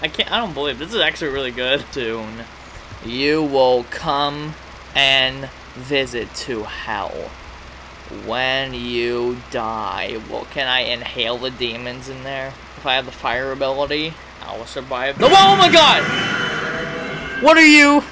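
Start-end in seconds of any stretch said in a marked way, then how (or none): 1.9-10.2 very slow, low villain voice; drawn-out sinister prophecy; villain threat. Acted. Reads villain's threat from game. 15.3-18.0 real loud, high shriek of alarm; high, shouted panic; startled. Real, not acted. Jump scare in the game.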